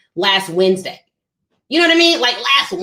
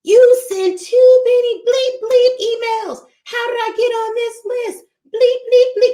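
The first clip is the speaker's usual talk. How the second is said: high, loud mock cursing; shrill-free high loud mock tirade; mock angry rant; 13 semitones higher, 3 dB louder than usual. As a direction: play the furious subscriber, hammed up. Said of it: "sent me an email cursing me out".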